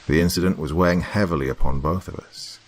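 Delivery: usual speaking voice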